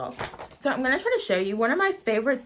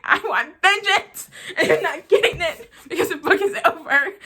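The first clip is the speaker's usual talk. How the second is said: much higher, louder, slowed mock-fury with a laugh; mock vengeful; 8 semitones higher, 4 dB louder than usual. Reviewer reliving her fury at the book ending, hammed-up and higher. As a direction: ham up craving revenge on the book. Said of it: "I felt violent".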